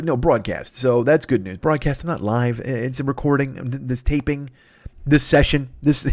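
ordinary talk, nothing marked

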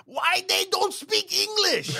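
put-on high, slow, drawn-out immigrant-accented complaint; exaggerated frustrated accented English; imitating immigrant dad's accent. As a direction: mimic a baffled dad's foreign accent. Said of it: "my dad".

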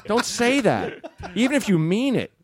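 put-on high-pitched pleading kid to Dad; embarrassed teenage kid. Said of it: "to your teenage kids someday".

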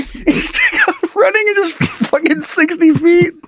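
slow, high giggly retelling; laughing out the words. Real, not acted. Mocking the sixty-foot run, laughing.

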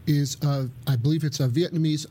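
ordinary delivery

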